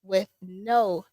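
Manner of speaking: ordinary talk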